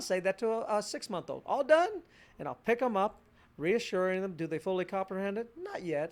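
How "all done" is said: put-on baby cooing. Parent cooing to six-month-old.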